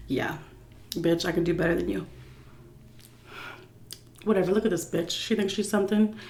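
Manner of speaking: put-on muttered drunken insults about the stripper; catty drunk mutter. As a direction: sneer at the dancer softly to yourself. Drunk self heckling stripper.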